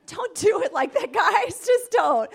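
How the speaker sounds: laughing loud while scolding. Real, not acted. Speaker to audience, loud laughing scolding. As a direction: laugh it off loudly, shaking the head. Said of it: "laughing at her own demo".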